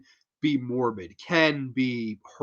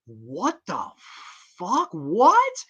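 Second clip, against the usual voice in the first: stunned disbelief; 4 semitones higher, much slower than usual. Retold or imitated. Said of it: "being like".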